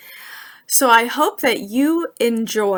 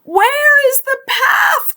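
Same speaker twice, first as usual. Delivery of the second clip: much higher, loud panicked shout; 7 dB louder, slower than usual. Acted. Toad, face covered in ice cream, shouts in panic. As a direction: yell it out, blinded and lost. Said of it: "cried Toad".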